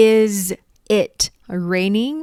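"Is", "it", "raining" with pauses between; slow separated words. Shown as a demonstration. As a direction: say each word alone. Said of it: "ฝนกำลังตกอยู่แม่นบ่จ๊ะ?". (พูดแยกทีละคำ)

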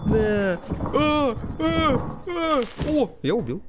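high, frantic strike cries; excited yelling. Real, not acted. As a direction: hammer it, yelling. Hitting crab in game.

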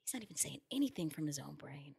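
whispered amazement; hushed whisper. Real, not acted. Whispering to her co-host in amazement. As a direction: drop to a whisper in disbelief.

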